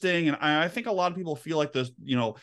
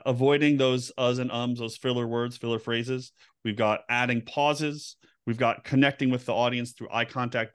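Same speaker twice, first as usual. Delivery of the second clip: ordinary talk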